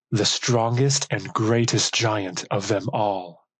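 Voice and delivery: ordinary delivery, nothing marked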